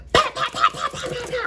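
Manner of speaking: rapid high-pitched pows; high staccato knocking noise; imitated door pounding. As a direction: fire off pows like a fist on the door.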